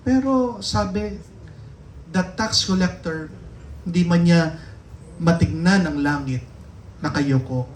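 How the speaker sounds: usual speaking voice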